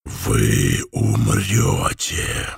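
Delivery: very low, slow, thunderous rasp; booming hoarse voice. Acted. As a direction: boom it out like thunder from the dark. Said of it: "хриплый и низкий голос, громом прозвучавший".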